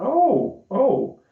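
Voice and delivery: high, lightly surprised oh; a touch of retold surprise; mock surprise.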